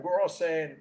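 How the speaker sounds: ordinary delivery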